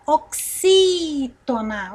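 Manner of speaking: XÍ of "oxítona" stretched long and strong; stressed XÍ. Shown as a demonstration. Teacher asks which syllable is tonic, stretching XÍ. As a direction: hold the second syllable of "oxítona". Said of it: "qual é a sílaba tônica da palavra".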